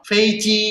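Fei and ji both high and level; "Fei, ji" joined up in a high level tone; first tone twice. Shown as a demonstration. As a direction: keep "Fei, ji" up on one high pitch. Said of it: "ini ada satu, ada satu".

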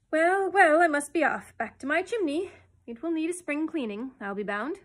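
loud, high, bustling voice; high, booming-up start that eases off; brisk farewell. A narrator's reading. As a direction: announce your exit loudly and briskly. Starling briskly announces his departure.

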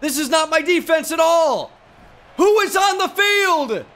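very high, stretched yells of disbelief; outraged shouting. Real, not acted. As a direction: shout in alarm at your players, pitch way up. Wrong players on the field.

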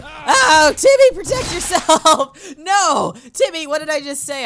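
loud, high-pitched mock panic; alarmed parental yell. Dad warning his son in a game, alarmed yell. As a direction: holler at the child like a frantic dad.

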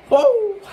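high, loud yelp; loud startled whoa. Real, not acted. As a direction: cry out as the heat hits. Pepper heat hits again.

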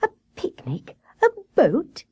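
hushed wonder jumping to a high cry; thrilled little rabbit's surprise; delighted cry. A narrator's reading. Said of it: "cried little Grey Rabbit".

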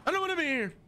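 scared in the daycare, high whiny plea; high whine. Real, not acted. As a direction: whimper it up high. Same line, high whine.

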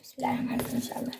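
fast whispered blessing; whispered prayer. Real, not acted. Quick prayer before the roll.

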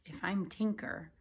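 usual speaking voice

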